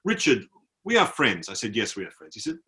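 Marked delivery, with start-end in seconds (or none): 0.0-1.5 put-on mildly emotional, accented declaration; imitating emotional soldier. Tipsy soldier declares friendship to tourist, imitated emotion. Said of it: "he got quite emotional". 1.8-2.3 lower, softer retold reassurance; humouring reply. Traveller humours tipsy soldier, low soft reply.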